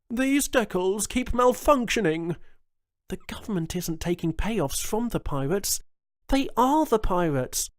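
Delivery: high, loud, urgent woman's voice; a politician caught out, voice up high; sharp high alarm; governor Jones. A narrator's reading.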